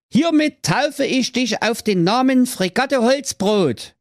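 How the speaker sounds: sehr hoch und feierlich gerufen; feierliche Taufe. Acted. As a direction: tauft es laut wie ein Priester. Pfaffe tauft feierlich. (hoher, feierlicher Ausruf)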